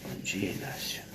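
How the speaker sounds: breathy hushed good morning; soft whispered greeting to Inácio. Real, not acted. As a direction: whisper it so as not to wake him.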